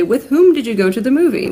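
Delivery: overly proper grammar-book tone; mock formal. Shown as a demonstration. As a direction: put on a stuffy, correct voice. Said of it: "We just don't really do that".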